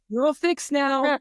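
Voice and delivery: high, slow mock reassurance. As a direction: draw it out high, as a self-styled rescuer. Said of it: "and was like".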